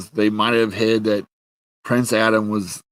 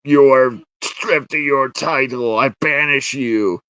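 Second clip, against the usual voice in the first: slow, higher pronouncement of banishment; hammed-up royal anger; mock angry king; 4 semitones higher, 3 dB louder, slower than usual. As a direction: proclaim it slowly like an enraged king. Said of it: "father just gets angry".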